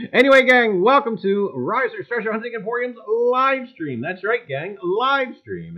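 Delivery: loud, high showman's welcome; announcer opener. Acted. Host greeting his livestream viewers with a loud showman's welcome. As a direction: hype up the gang like a ringmaster. Host's booming stream opener.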